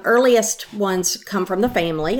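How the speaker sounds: normal talking voice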